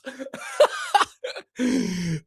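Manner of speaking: long high-pitched laugh; excited laughter. Real, not acted. Pumped fan letting it out, long giddy laugh.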